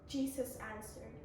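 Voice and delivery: ordinary delivery, nothing marked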